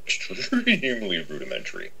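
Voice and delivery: amused, soft laugh into the words. Real, not acted. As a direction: chuckle softly at your own crude work. Laughs at own first crude work.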